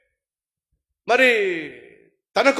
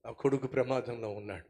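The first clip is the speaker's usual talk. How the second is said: much softer and lower, heavy; grieving father; 12 semitones lower, 15 dB quieter than usual. Acted. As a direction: answer the servants through grief. David answering in grief.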